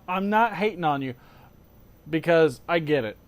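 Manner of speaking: ordinary delivery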